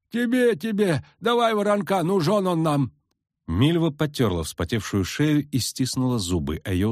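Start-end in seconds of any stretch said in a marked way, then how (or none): 0.1-2.9 high, folksy village peasant; rustic old villager, eager. A narrator's reading. Peasant insisting.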